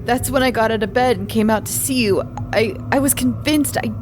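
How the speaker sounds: tearful, high and trembling; frightened young man close to tears; crying. Acted. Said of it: "He was crying now".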